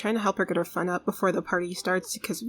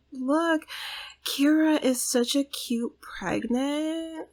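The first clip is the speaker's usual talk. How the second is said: cute coo; 6 semitones higher, much slower than usual. Real, not acted. Cooing at pregnant Sim.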